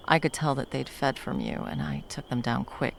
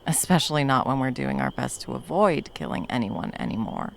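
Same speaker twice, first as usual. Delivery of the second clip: normal talking voice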